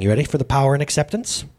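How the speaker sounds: ordinary talk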